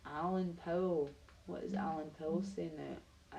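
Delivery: ordinary talk, nothing marked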